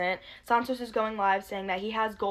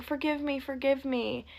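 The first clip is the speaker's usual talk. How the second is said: higher, lightly pleading flirt; mock flirting; 5 semitones higher than usual. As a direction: imitate someone coaxing forgiveness flirtatiously. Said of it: "biting his lip and flirting with Ash".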